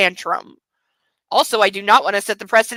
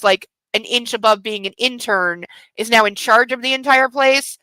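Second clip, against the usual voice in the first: ordinary delivery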